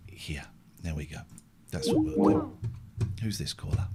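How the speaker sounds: soft, unvoiced aside; whispering. Real, not acted. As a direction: breathe it out quietly. Drops to near whisper.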